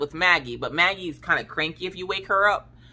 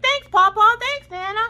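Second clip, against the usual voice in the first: put-on much higher, sweet child thanks; kids' voices; 5 dB louder than usual. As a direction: sound like little kids thanking grandparents. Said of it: "and they're like".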